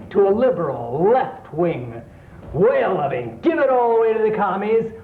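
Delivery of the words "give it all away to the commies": ordinary talk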